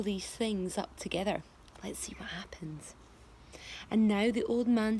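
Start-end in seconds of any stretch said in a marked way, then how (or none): 1.8-3.0 low secret whisper, slowing down; hushed storyteller whisper. Acted. Reading to the class in a hushed whisper. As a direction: whisper it, drawing the children in.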